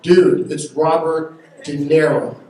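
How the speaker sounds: loud and slow, pleading; urgent shout. Retold or imitated. Son loudly urging his father to meet De Niro, slow exasperated shout. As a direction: stretch it out, almost yelling.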